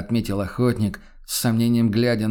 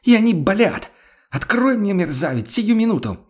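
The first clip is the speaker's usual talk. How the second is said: furious shriek; 10 semitones higher, 4 dB louder than usual. Acted. Furious woman shrieking through door.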